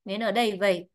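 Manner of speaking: normal talking voice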